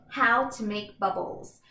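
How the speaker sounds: ordinary talk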